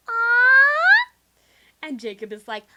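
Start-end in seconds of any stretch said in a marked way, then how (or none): 0.1-1.1 high, loud squeal of cuteness; cooing "aw". Retold or imitated. Dreamer cooing at a kitten, loud high squeal. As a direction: melt at a tiny kitten. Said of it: "And I'm like".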